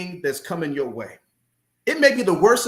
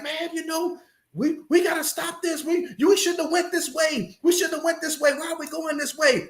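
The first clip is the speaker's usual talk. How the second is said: high, whiny worried wife voice; complaining wife; 11 semitones higher than usual. Retold or imitated. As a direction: whine it like a wife scared of the storm. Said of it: "she's complaining".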